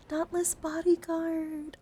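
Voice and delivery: high, loud, drawn-out excited announcement; excited reveal. Real, not acted. Streamer showing viewers a favourite card, excited. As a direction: sing out the card name with delight.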